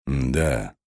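a touch of hoarseness on a low answer; slightly hoarse. A narrator's reading. Man answers whether it's a compliment, slightly hoarse. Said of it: "ответил он хрипло".

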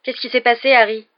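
a loud, high, thundering question; a startled boy booming his question. Acted. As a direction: boom it across the dormitory. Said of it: "d'une voix tonitruante".

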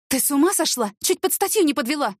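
high-pitched, shaken reproach; indignant scolding. A narrator's reading. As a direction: scold after a near miss. Frightened driver scolds reckless pedestrian. (возмущённо, на повышенном тоне)

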